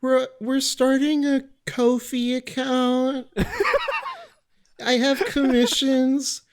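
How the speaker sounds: very high, slow put-on voice; mock sales pitch. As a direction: sell commissions in a silly voice.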